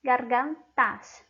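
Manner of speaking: gargantas stretched out slowly, every syllable clear; slow drawn-out plural. Shown as a demonstration. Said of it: "termina con una vocal A".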